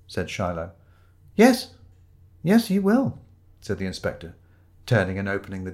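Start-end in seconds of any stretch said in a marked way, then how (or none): 1.3-3.2 high, pointed agreement; emphatic confirmation. Acted. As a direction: rub it in, pitched high. Inspector relishes sending Shiloh away.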